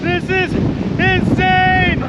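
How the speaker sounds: calling out against the wind; wind in the face. Real, not acted. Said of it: "It's so windy out here".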